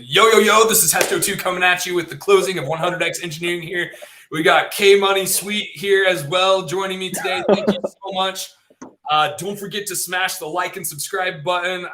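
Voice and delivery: loud, hyped streamer intro. Pretend. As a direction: pump it up like a Twitch streamer. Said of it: "can I do the streamer voice".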